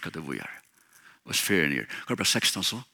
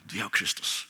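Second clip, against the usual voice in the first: hushed assurance; 5 semitones lower, 7 dB quieter than usual. Real, not acted. Preacher softens to an intimate assurance.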